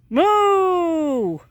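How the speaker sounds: high, loud imitated cow moo; playful cow call. Shown as a demonstration. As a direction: bellow a moo at the cows. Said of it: "You gonna moo some more?".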